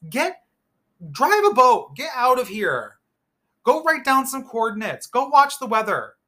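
high, loud fed-up commands; exasperated yelling. Pretend. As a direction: shoo him off, really loud. Shouting orders at the captain.

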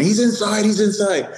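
high-pitched, insistent repeat; retold cry for help, pitch way up; urgent alert. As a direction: imitate a frantic witness. Witness urgently directs arriving officer.

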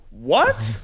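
ordinary talk

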